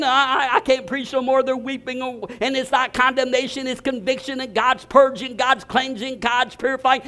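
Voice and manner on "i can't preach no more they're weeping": usual speaking voice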